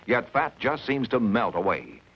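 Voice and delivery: usual speaking voice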